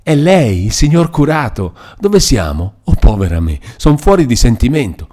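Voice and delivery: high, loud bewildered cries; astonished exclamation. A narrator's reading. Captive recognising her parish priest, exclaiming in bewildered astonishment. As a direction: cry out in disbelief and distress. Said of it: "Lo fissò di nuovo e esclamò".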